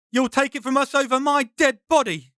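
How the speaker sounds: high, defiant cry at the brigand; defiant shout. Acted. Ben defies the armed brigand, high defiant shout.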